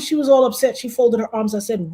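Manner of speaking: ordinary delivery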